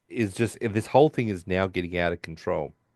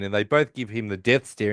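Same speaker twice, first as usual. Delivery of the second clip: usual speaking voice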